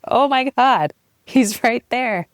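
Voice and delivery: re-enacted amazement. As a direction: relive the sudden wonder, pitch jumping up. Acts out realizing baby's head emerging.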